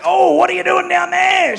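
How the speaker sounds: high, loud and slow, mock alarmed; a startled yelp, drawn out; alarmed surprise. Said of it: "I remember looking down at her like".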